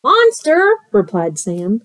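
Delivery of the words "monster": high-pitched alarmed cry; scared kid voice. Acted. Scared kid replies about the monster, high alarm. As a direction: squeak it out like a frightened child.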